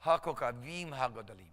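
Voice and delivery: HaKokavim HaGodalim run together smoothly. Shown as a demonstration. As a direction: join HaKokavim HaGodalim into one flowing phrase. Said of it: "say it a little faster".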